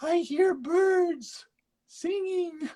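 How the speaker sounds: put-on very high, very slow old lady voice; hammed-up granny wonder; mock old lady. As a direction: marvel like a dotty old woman. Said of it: "he's like".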